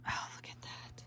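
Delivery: hushed, breathy whisper of wonder; awed whisper. Real, not acted. Unboxing crafter whispering to viewers in awe. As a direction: whisper over the kit in awe.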